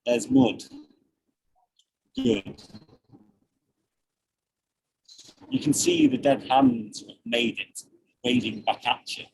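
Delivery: slow spoken-word poem; poem reading. Acted. Reads his short poem.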